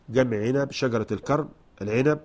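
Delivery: usual speaking voice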